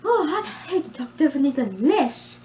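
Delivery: high, loud, swooping character voice; hammed-up British character exclaiming; Ludwig. Shown as a demonstration. Character protests at talking differently, high and loud. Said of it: "you'll see how his original voice was".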